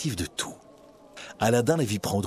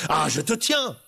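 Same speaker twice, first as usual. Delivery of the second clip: mock shout; 4 semitones higher than usual. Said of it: "hurla-t-il".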